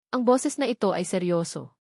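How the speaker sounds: usual speaking voice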